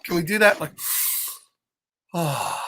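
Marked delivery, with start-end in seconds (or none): 2.1-2.6 long soft low exhale; relieved sigh. Real, not acted. Showing the children how to let out a breath. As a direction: breathe it out slowly and softly. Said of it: "I always feel better when I do that".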